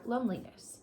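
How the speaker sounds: normal talking voice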